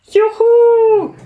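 high, loud squeal of joy; delighted cheer. Acted. The little drop rushes toward Earth, a loud high whoop. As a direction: whoop it out while falling fast. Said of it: "jubelte der kleine Wassertropfen begeistert".

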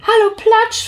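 high, loud, glad hello; delighted greeting. Acted. Plitsch spots his friend beside him, high happy hello. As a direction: greet your friend with joy. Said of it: "freute er sich".

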